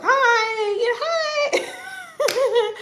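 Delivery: very high, drawn-out cheery hi; happy greeting. Retold or imitated. Happy person greeting everyone as always, exaggerated cheer. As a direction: imitate your own over-happy squeal of hello. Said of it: "I'm such a happy person".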